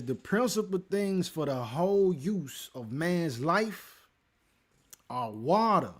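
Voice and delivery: preacher reading out scripture deliberately; emphatic scripture reading. Acted. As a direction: proclaim the verse, landing hard on key words. Reads scripture aloud to viewers.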